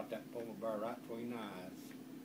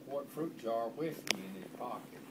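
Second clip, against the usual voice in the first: ordinary talk, nothing marked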